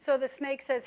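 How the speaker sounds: ordinary talk, nothing marked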